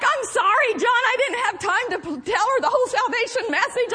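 high laughing mock apology. Grandma apologizing to her son, laughing. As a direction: giggle through a sheepish sorry.

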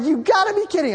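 high incredulous exclamation; disbelief. Retold or imitated. Preacher voicing his thought about the excuses, high and disbelieving. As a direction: scoff as if it's absurd. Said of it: "I thought, like".

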